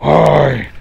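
low, slow creepy hello; menacing greeting. Shown as a demonstration. Streamer showing how he greets people in his mask, low menacing hi. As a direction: drop the voice to spook them. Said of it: "That's how I approach you".

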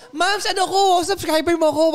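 high, loud fan pitch; eager subscriber. Retold or imitated. Streamer plays a subscriber approaching, high and eager. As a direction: call out to get the streamer noticed.

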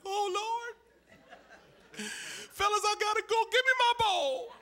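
very high, comic falsetto panic; mock panic. Boy telling friends he must go, mock panic. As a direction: squeal it like a boy in trouble. Boy hearing mother's summons.

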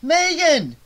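urgent shout across the landing. A narrator's reading. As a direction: call out to Megan urgently. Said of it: "he shouted".

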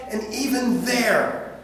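usual speaking voice

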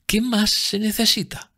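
high, lingering, delighted voice; musical rapture. A narrator's reading. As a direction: let it float up high and slow. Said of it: "su voz llena de música". (embelesada, con voz aguda y musical)

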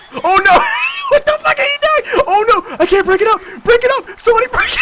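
high frantic yelling; panicked laughing shout. Real, not acted. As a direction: yell frantically, half laughing.

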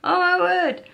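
high, loud, slow squealing; overwhelmed by cuteness. Real, not acted. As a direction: squeal over something adorable. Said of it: "should make you make those noises".

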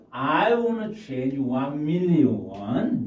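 each word slow and separate; slow model phrase. Shown as a demonstration. Teacher modelling a phrase slowly for students. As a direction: spell it out slowly for learners. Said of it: "teacher models English phrase".

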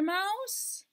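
high rising call. Acted. Cat calling for Mouse under the hat, high.

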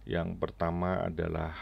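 usual speaking voice

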